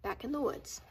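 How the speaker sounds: usual speaking voice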